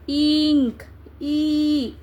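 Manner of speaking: "इंक", then its first sound "ई" held long; vowel sound demo. Shown as a demonstration. Teacher showing students the vowel sound, drawn-out "ई" after "इंक". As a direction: draw out the opening "ई" of "इंक". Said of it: "sound भी भा-, वावेल है".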